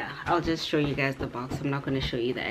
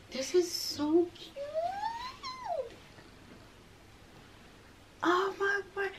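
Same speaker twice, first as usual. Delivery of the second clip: giddy squealing; 13 semitones higher, slower than usual. Real, not acted. Opening her new dress, gasping with delight. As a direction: gush over the dress in a high squeal.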